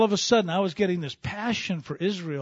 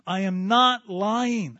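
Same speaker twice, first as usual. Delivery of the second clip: very slow, louder and higher, every word pressed; I am not lying, drawn out with insistence; Paul insisting; 5 semitones higher, 6 dB louder, much slower than usual. Acted. Paul assuring the Romans, slow and insistent. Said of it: "He goes".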